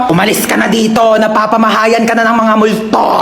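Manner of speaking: usual speaking voice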